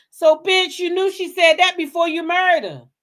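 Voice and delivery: high, loud ranting; furious yelling. Real, not acted. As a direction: let him have it at full volume. Rant peaks at Simon.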